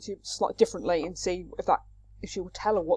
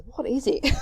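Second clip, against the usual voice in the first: higher, hammed-up exasperated question; exasperated demand; 5 semitones higher than usual. Nurse pressing Phaedra for an answer, exasperated.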